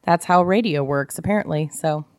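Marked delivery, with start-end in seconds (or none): none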